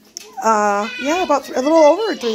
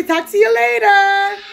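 put-on baby talk; 9 semitones higher, 6 dB louder, slower than usual.

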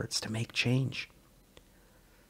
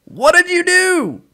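much higher, loud demand; demanding shout; 14 dB louder than usual. Acted. Joe yells at Sully to confess what he did. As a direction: yell the question at him.

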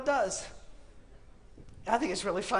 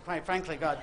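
ordinary talk

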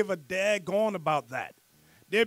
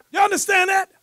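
much higher, loud challenge; preaching shout; 11 semitones higher, 9 dB louder than usual. Real, not acted. Preacher to the congregation, loud preaching challenge. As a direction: hammer the question home.

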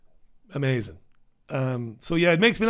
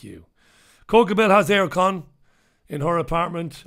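ordinary talk, nothing marked

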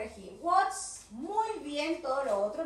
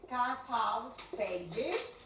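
slower still, softer; 2 semitones lower, 3 dB quieter, much slower than usual. Shown as a demonstration. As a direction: cut out pages very slow and soft. Repeated slower.

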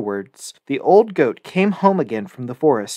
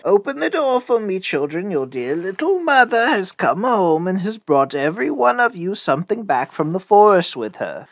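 higher, put-on mother voice; wolf posing as mother; 5 semitones higher than usual. A narrator's reading. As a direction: coax the children as a fake mother. Wolf poses as mother again.

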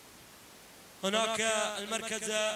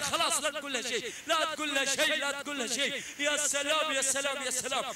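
higher, energetic race call; live play-by-play; 5 semitones higher than usual. Real, not acted. Commentator calling the camel race finish, energetic play-by-play. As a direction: keep the race call running hot.

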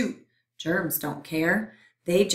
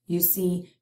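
ordinary delivery, nothing marked

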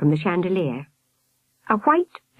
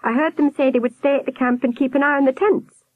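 put-on high-pitched boy's voice; explaining boy; 7 semitones higher than usual. A narrator's reading. Albert telling the nurse what he heard, high boy voice. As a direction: pitch it up like an eager child.

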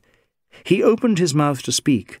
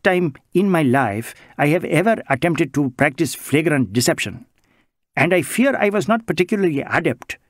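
put-on higher, lilting Indian-accented voice, anxious and earnest; Bengali social worker; 3 semitones higher than usual. Bengali social worker protesting to the inspector, higher Indian-accented voice. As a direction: play the mild Bengali gentleman pleading his innocence.

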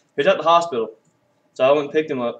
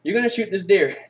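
higher-pitched retelling of the officer; quoted cop; 7 semitones higher than usual. Retold or imitated. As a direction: act out the cop giving an instruction. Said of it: "he said".

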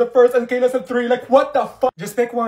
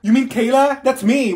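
ordinary talk, nothing marked

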